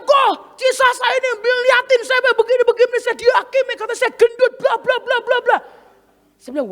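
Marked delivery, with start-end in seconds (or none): 0.0-5.6 high, tearful-sounding mock tattling; hammed-up complaining girl; whiny complaint. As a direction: imitate her running to the boss. Dancer complains to her boss.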